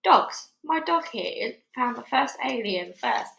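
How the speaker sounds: plain reading voice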